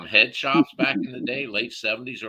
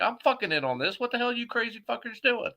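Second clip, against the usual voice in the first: much higher, excited pleading; eager demand; 12 semitones higher than usual. Retold or imitated. Younger brother begging in on the tank, high eager excitement. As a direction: jump in wanting a share. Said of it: "I'm like".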